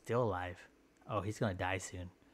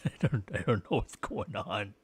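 ordinary delivery

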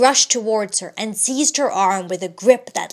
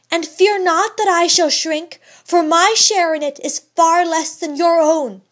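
higher, louder, steady and resolute; firm wife; 7 semitones higher, 6 dB louder than usual. A narrator's reading. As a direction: hold your ground with your husband. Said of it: "with the firmness of which she possessed".

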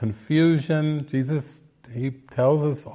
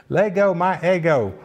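imitated little boy's Southern catchphrase, pitched higher; Southern accent; 4 semitones higher than usual. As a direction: mimic a Southern kid in a waffle commercial. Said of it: "with a southern accent".

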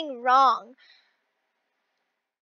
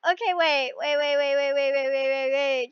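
low rapid chant, holding for the moment; muttered countdown; 4 semitones lower than usual. Real, not acted. Player waiting to jump, low muttered repetition. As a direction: rattle off the waits in one low, even string.

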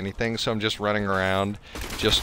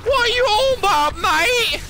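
very high, loud Cockney squawk; ignorant Cockney; 5 dB louder than usual. Acted. Player imagining a demon hunter with funny accent, ignorant Cockney. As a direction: screech it in an exaggerated Cockney accent. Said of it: "a fucking Cockney accent".

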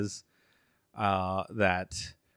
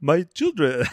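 higher, mock Ethiopian-accented God; lightly faked Ethiopian accent, pitched up; attempted Ethiopian accent; 5 semitones higher than usual. Correcting how God sounds, in a mock Ethiopian accent. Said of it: "It sounds Ethiopian".

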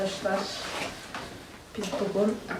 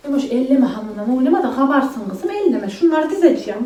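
loud, raised scolding; 3 semitones higher, 7 dB louder than usual. Real, not acted. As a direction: warn her off the dough. Kız kardeşe seslenip uyarıyor. (yüksek sesle azarlama)

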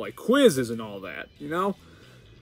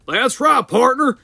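high, loud Southern cowboy voice; hammed-up Southern accent, pitched high; 10 semitones higher, 7 dB louder, slower than usual. Shown as a demonstration. Player showing his America ball character, Southern accent. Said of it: "I gave him the Southern accent".

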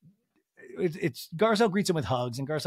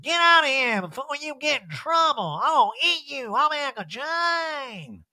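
high, loud, wailing holler; hammed-up drunken threat; mock calling out; 10 semitones higher, 4 dB louder, slower than usual. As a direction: yell after someone leaving, last word stretched. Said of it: "And Erica calling after him".

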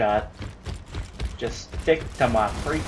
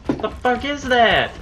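raised, high, exasperated complaint; pissed off; 7 semitones higher, 5 dB louder than usual. Real, not acted. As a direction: vent real anger, pitch jumping up. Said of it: "I'm pissed".

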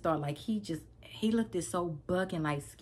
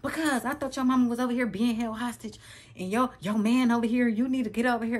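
retold yelling; 6 semitones higher, 4 dB louder than usual. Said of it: "So I'm yelling".

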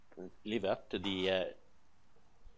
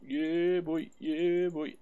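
excited cheer; 9 semitones higher, 7 dB louder, much slower than usual. Real, not acted. Celebrating a success.

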